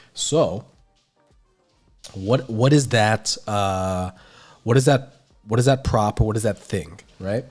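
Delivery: normal talking voice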